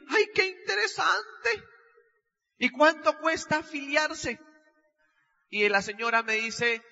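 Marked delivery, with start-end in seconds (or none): 0.1-4.4 high, slow, starry-eyed; eager interest. Retold or imitated. Eager young man asking the saleswoman the price, high and slow. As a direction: lean in like a sucker hooked.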